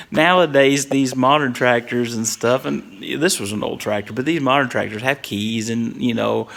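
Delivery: ordinary talk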